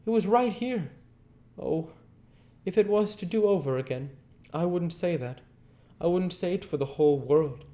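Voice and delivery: put-on high girlish voice, near tears, quickening; a little girl full of regret; Becky grieving. A narrator's reading. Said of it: "said to herself".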